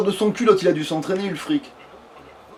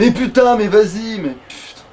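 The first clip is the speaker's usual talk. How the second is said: sudden higher, louder swearing outburst; exasperated; 5 semitones higher, 5 dB louder than usual. Real, not acted. Player cursing as the game resists, exasperated. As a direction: swear in frustration at the game, then deflate. Game frustration mid-play.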